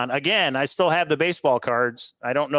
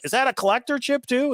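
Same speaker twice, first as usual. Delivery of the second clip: eager question, much higher pitch; excited buyer; 11 semitones higher than usual. Retold or imitated. Buyer asking the seller about the chip, excited and high. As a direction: retell the buyer's thrilled question. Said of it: "he was all excited".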